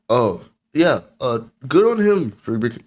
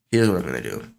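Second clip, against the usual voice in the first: usual speaking voice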